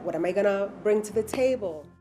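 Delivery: ordinary delivery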